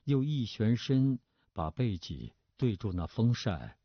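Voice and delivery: ordinary delivery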